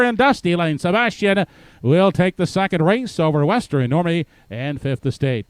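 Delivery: building race call, rising high and loud; race caller. Acted. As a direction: drive the stretch call to the wire. Stretch call building to finish.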